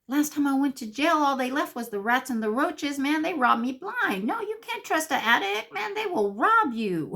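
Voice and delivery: high-pitched, hammed-up complaint; indignant protest. Newcomer objects to trusting addicts, high hammed-up indignation. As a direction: climb high in mock outrage at the idea.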